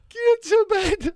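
high, slow squeaky threat; high mock gaffer. Gaffer threatening player caught out late, high mock voice. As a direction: drag it out in a silly high boss voice. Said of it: "So he goes".

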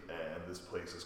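quiet so as not to wake anyone; hushed. Real, not acted. People sleeping upstairs, late night.

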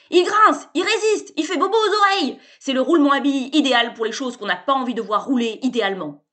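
loud, high, delighted salesman; excited pitch. A narrator's reading. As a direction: sell it with mounting gusto. Sébastien's pitch.

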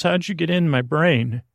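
usual speaking voice

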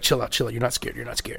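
low soft self-talk while scared; self-soothing murmur. Retold or imitated. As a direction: talk yourself down quietly. Calming himself watching horror show.

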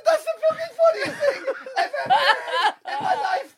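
can barely speak for laughing. Real, not acted. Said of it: "laughing through quote".

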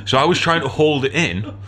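ordinary delivery

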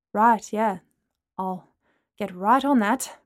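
a grudging, sour reply; irritable. Acted. Bentley irritably agreeing to order Lana's drinks. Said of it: "Bentley said irritably".

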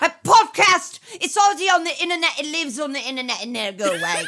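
a hammed-up Cockney defender who can't keep a straight face; lightly done Cockney, speeding up into a laugh; mock Cockney. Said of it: "like, Cockney".

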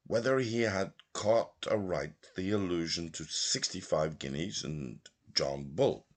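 ordinary talk

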